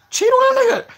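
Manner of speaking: high teasing yelp. Real, not acted. Teasing advice to viewer.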